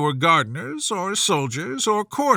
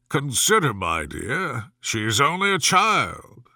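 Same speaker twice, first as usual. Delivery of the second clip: usual speaking voice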